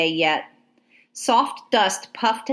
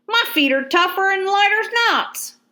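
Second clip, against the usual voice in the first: much higher, twangy Georgia soldier boast; Georgia drawl; 12 semitones higher than usual. A narrator's reading. Soldier answers Ladysmith, Georgia drawl. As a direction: brag it country-style. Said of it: "a Georgia accent".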